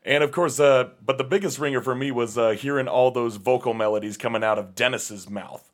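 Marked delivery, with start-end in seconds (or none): none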